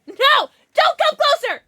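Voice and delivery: high, loud shriek at the dog. Real, not acted. Owner shrieking at her dog to stay away, high and loud. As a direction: yelp it to keep him back.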